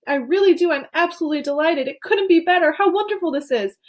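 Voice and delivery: high, gushing delight; excited. A narrator's reading. Wonka congratulating Charlie, overjoyed and high. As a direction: gush with joy. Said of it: "he cried".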